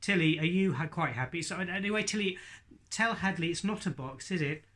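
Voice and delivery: ordinary delivery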